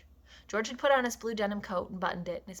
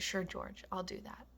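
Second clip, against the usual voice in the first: soft, subdued yes; barely above a murmur; hushed, resigned reply; quiet agreement; 10 dB quieter than usual. A narrator's reading.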